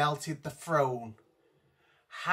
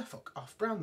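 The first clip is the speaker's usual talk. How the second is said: whispered aside; 3 semitones higher, 9 dB quieter than usual. Real, not acted. Reading the line from his notes.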